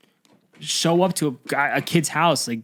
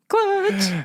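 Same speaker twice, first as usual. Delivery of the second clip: high-pitched mock catchphrase; jokey falsetto tag; playful high exclamation. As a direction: squeak it out high for fun. Playful sign-off after marking clip.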